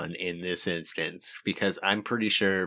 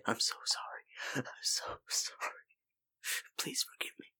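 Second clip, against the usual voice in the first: whispered apology; 6 semitones higher, 12 dB quieter than usual. Acted. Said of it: "She's gonna say".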